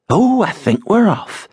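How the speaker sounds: high, tight-lipped and prim; change the subject primly; prim wife. A narrator's reading. Wife shutting down the row, high and prim. Rosa deflecting.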